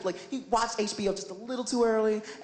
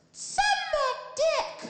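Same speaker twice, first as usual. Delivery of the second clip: put-on bratty kid shriek; much slower than usual. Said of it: "And he goes".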